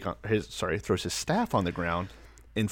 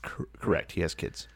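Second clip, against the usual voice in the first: whispered; 5 semitones lower, 7 dB quieter than usual. Real, not acted. Unvoiced low line.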